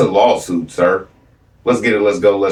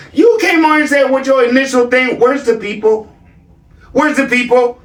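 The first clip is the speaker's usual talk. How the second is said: usual speaking voice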